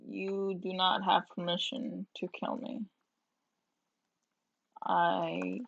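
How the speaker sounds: ordinary delivery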